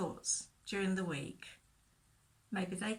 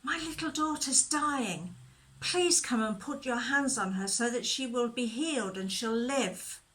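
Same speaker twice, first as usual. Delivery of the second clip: urgent plea, pitched up at the start; pleading; 3 semitones higher, 3 dB louder than usual. Acted. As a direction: plead earnestly for help. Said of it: "he pleaded with him".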